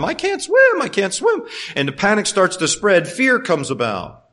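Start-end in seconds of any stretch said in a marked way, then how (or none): 0.0-1.4 high-pitched imitation of a panicked cry; mock panicked cry. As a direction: squeal it out like someone mock-drowning. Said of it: "you're crying out the whole time".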